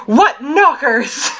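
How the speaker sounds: hammed-up classic comedy line, drawn out; quoting a movie line. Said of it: "that clip from Young Frankenstein where he's like".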